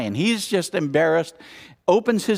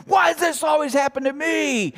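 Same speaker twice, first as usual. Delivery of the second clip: mock the chronic complainer; whiny complainer; 10 semitones higher than usual. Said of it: "says that and acts that way".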